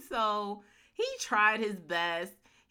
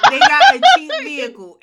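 laughing; 20 dB louder than usual. Real, not acted. Laughing at cheap car.

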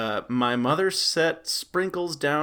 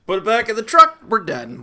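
luid uitroepen; 6 semitones higher, 9 dB louder than usual. Acted. Acted reaction to haunted house.

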